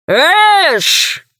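high cry that rises and falls; narrated shout from close by; sudden cry. A narrator's reading. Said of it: "раздался совсем близко крик".

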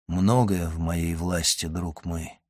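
low, soft answer; quiet, composed. A narrator's reading. Count answering his awestruck friend, quiet and composed.

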